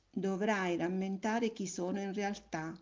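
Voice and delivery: ordinary delivery, nothing marked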